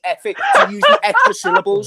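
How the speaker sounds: high, loud laughing brag. Real, not acted. Boasting to friends about saying the full name, laughing. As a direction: giggle through the boast.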